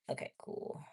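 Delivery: whispered okay cool to herself; whispered aside. Real, not acted. Checking the cards again, a whispered aside. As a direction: breathe it out under your breath.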